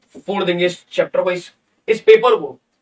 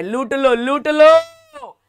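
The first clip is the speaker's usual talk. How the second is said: high, long-drawn call to loot; hawker's call; 7 semitones higher, 4 dB louder, much slower than usual. Acted. Teacher urging students to grab free marks, hawker's call. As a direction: sing it out like a market seller, stretching the last word.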